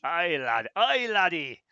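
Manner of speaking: mock Scottish "aye, laddie" shouted; loud joking Scottish accent. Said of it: "The deep north".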